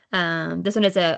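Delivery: ordinary delivery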